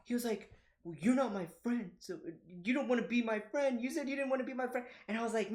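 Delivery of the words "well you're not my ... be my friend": high, whiny child imitation; mock hurt, words tumbling out; heartbroken kid. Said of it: "was heartbroken".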